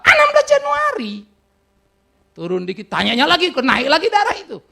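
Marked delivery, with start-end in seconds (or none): 0.0-1.1 much higher and louder, fed up; husband snapping the date at his crying wife; exasperated shout. Retold or imitated. Pastor retells snapping the date.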